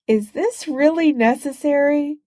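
high, plaintive whine. A narrator's reading. Spider-Man from under Doom's foot, high and plaintive. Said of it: "said Spider-Man plaintively".

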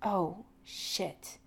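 quiet, dawning realisation. A narrator's reading. Jim realising McCoy was serious, quietly. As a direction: let it sink in, softly. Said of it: "he finally says, quietly".

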